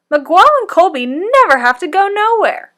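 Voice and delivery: put-on high, loud complaining kid; whiny boy. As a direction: whine like a bored child.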